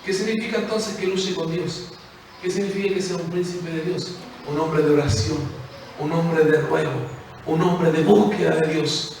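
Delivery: usual speaking voice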